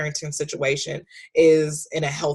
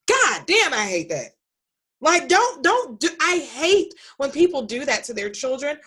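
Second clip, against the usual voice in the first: annoyed outburst; 10 semitones higher than usual. Real, not acted.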